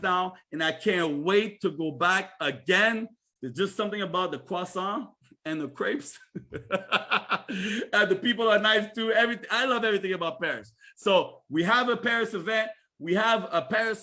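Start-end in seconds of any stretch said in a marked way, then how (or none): 6.3-7.9 real, amused laughter; hearty laugh. Real, not acted. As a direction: laugh at your own food joke. Laughs after joking about crepes.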